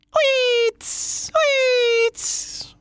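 very high whooshing whistle; whizzing wheel. Acted. Catherine wheel whizzes round, high whistling. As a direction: spin the sound out like a firework. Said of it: "machte das Feuerrad".

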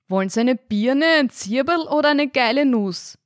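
high innkeeper's call across the room. A narrator's reading. Landlord calling from the bar, high projected call. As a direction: holler the choices from the bar. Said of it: "rief der Wirt von der Theke".

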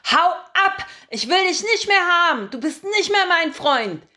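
put-on high, loud furious little-girl yell; a child lashing out, screaming at him; screaming angry child. A narrator's reading. Little Mimi screams furiously at the narrator. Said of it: "brüllt und schlägt sie nach mir".

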